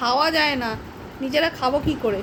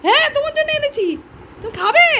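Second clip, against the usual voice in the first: talking to the dog; 9 semitones higher than usual. Real, not acted. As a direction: coax the dog with a treat, voice up high. High sing-song talk to dog.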